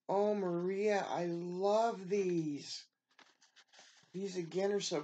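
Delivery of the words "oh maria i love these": louder, high, drawn-out love; swooning slow thanks; heartfelt gush. Real, not acted. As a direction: savor every word of thanks. Thanking the gift sender.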